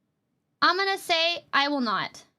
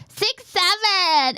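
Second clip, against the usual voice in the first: slow, drawn-out, higher and louder shout; excited meme shout; 5 semitones higher, 4 dB louder, much slower than usual. Pretend. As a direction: stretch the meme out like a hype chant. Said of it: "You're gonna go".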